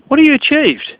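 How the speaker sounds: mocking. Real, not acted. Mocking rant at [name] impersonator.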